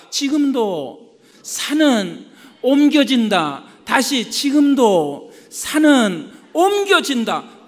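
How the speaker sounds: loud, slow, measured pulpit chant; call-and-repeat declaration. Shown as a demonstration. Preacher has the congregation say it together, loud slow declaration. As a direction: lead the crowd phrase by phrase, loudly. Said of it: "같이 합시다".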